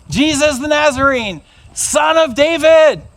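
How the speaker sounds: drawn-out, pleading shout; blind man's shout. Acted. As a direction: cry out over the crowd. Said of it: "I'm gonna shout".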